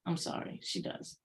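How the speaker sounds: an unvoiced whisper, as if letting slip a secret; whispered aside. Real, not acted. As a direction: whisper it, half apologetic. Blunt aside after criticizing character.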